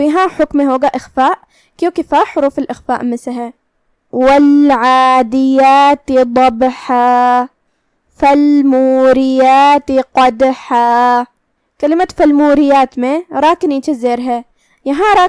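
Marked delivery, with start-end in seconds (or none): 4.1-11.2 slow, drawn-out melodic tajweed chant; Quran recitation. Shown as a demonstration. Teacher recites the verse to students in a slow melodic chant. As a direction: recite each word slowly and melodically, letting the vowels ring.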